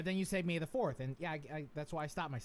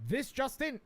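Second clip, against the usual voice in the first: put-on Transatlantic accent; 8 semitones higher, slower than usual. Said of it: "Transatlantic Voice".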